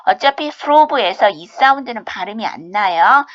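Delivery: ordinary talk, nothing marked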